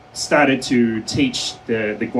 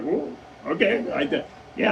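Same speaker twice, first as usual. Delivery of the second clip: small, high, much softer stammer; meek backing down; 6 semitones higher, 7 dB quieter than usual. Retold or imitated. Detainee answering the official who yelled at him, meek. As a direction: shrink back with a hushed, faltering reply.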